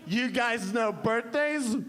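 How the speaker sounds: slow, high, rising teaser question; arrogant pitch. Acted. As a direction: pitch it slowly as a grand reveal. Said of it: "this arrogant woman".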